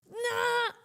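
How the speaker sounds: high, drawn-out "nah" wail; hammed-up cry of dismay; mock crying wail. Streamer pretends to cry over missing the trade, mock wail. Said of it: "I'm trying not to cry".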